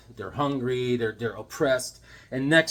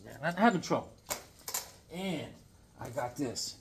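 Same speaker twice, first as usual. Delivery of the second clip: ordinary delivery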